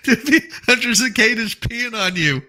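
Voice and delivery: high, loud squeal through real laughter; helpless laughter. Real, not acted. Repeating the cicada clip to viewers, cracking up. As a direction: barely get it out for laughing.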